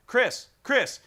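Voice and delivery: loud insistent calling of a name. Retold or imitated. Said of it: "is saying".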